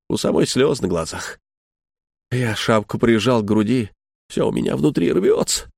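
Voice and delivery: usual speaking voice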